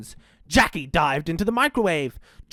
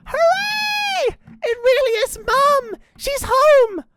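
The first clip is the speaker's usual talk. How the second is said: put-on very high, loud children's cheer; 5 dB louder, slower than usual. A narrator's reading. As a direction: squeal it out like overjoyed little joeys. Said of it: "The Joeys cheered".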